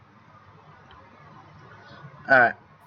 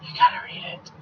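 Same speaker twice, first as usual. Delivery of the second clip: hushed, softer whisper; whispered prompt; 5 dB quieter, much faster than usual. Real, not acted. Prompting co-reader to take a turn, whispering. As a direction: whisper it to your partner.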